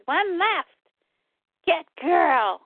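high, broken monster speech; clipped creature grunts. Acted. The Parademons hunting the girl, a high monster voice. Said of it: "Parademons are like".

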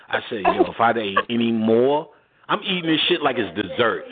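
usual speaking voice

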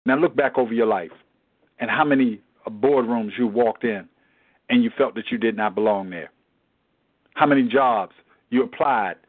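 normal talking voice

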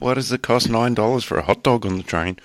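high, indignant mock question; mock whiny question. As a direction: ask it like a baffled kid. Mocking silly word problems.

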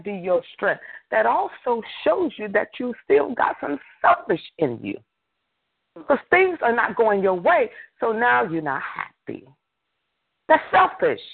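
high-pitched, emphatic sermon delivery; forceful preaching. Real, not acted. Preacher urging listeners to stop giving in to the devil, forceful. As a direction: preach it hard, pitched well up.

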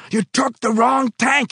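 high, loud, slow furious shout. Acted. Foreman shouting at the worker about the wrong tank. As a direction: blast the mistake at him. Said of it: "he shouted".

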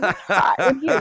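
hot said with a laugh; laughing appreciation. Shown as a demonstration. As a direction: chuckle it while checking people out. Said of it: "at a club".

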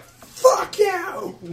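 put-on very high, startled kid voice; surprised curse. Kid looking back at pursuers, surprised cursing. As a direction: yelp the curse like a cornered youngster. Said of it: "he looks surprised. He just cursed, but he's like".

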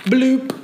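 high, popped plop sound; cartoon bloop. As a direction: pop it like a drop. Imitating poison dropped in well.

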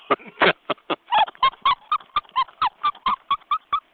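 long, high laughing fit. Real, not acted. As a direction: break into a high giggle. Laughs at own remark.

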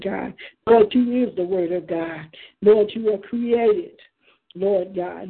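usual speaking voice

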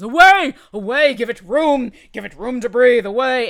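loud, urgent shouts to back away; shouting Waylon. Acted. Waylon yelling at the party to get back from the cart.

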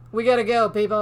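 rally people to leave now; calling out. Retold or imitated. Cassius calls crowd away.

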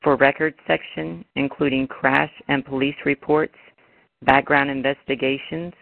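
recorded automated phone menu; flat prerecorded menu prompts. Real, not acted.

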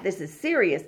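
ordinary talk

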